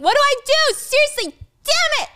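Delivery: high, loud frantic yell; yelling Michael. Retold or imitated. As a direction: yell in panic at the table. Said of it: "is yelling".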